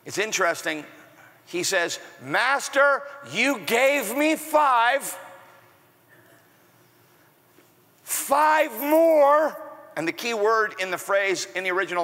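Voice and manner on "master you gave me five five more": proud servant showing his master the gain; acted-out servant. Shown as a demonstration. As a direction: present it slowly, then lift the reveal. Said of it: "I gotta just kinda act this out".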